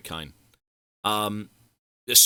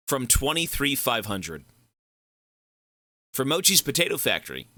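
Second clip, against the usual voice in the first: ordinary delivery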